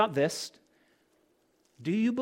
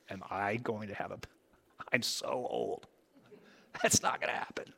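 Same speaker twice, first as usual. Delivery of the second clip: hushed inner doubt; 7 semitones lower, 8 dB quieter than usual. Acted. Sarah's inner doubting thoughts voiced.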